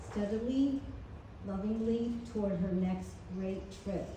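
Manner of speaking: ordinary talk, nothing marked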